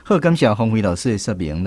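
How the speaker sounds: ordinary talk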